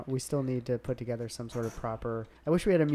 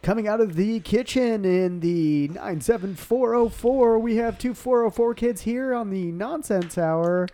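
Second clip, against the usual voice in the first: higher, loud, slow announcer; 8 semitones higher, 11 dB louder, slower than usual. Pretend. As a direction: boom it out like a ring announcer. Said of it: "I'll be like".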